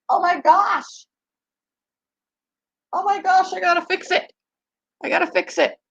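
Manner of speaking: high, fretful little voice; frantic mother hamster. Pretend. Mother hamster hurrying to re-cover her babies, high frantic fretting. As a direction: fuss like a tiny panicked mom.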